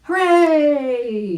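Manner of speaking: high, loud hooray; shouted cheer. Acted. Mister Kingfisher cheering the divers, loud high shout. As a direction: cheer it out big. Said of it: "shouted Mister Kingfisher".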